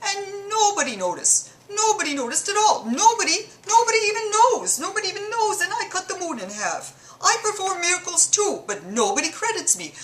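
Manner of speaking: high, put-on Mideastern accent, whining; a hammed-up Mideastern accent, aggrieved and boastful; bad Mideastern accent. Muhammad complaining nobody credits his miracle, bad Mideastern accent. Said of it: "my accent hasn't improved whatsoever".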